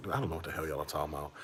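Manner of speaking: soft, fast under-the-breath grumble; muttered aside. Retold or imitated. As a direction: mutter it to yourself, exasperated. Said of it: "I'm just like".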